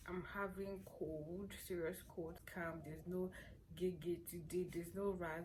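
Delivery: ordinary talk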